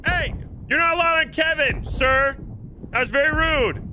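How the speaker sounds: mock indignant. Scolding boarder off his boat.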